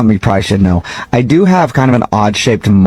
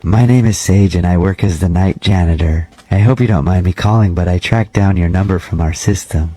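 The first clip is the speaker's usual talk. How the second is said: measured, slightly lowered janitor voice; lightly voiced creepy janitor; 5 semitones lower than usual. Night janitor cold-calling a gym member, lightly creepy voice. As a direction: play a polite, oddly intimate night janitor, understated.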